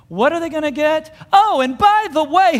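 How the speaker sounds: high, loud mock indignation; comedic outburst. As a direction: blurt it out high, played for laughs. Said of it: "it's kind of a comedic thing".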